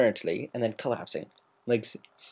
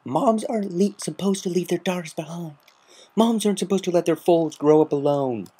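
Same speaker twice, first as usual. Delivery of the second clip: high, raw tearful protest; anguished sobbing protest; 10 semitones higher, 4 dB louder than usual. A narrator's reading. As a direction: cry it out through tears. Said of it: "tears streaming down her face".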